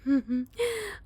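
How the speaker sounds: high gloating laugh; gleeful villain laugh. Acted. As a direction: cackle in delight. Captive gloats as crash happens.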